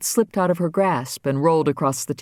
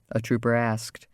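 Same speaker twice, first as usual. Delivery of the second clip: usual speaking voice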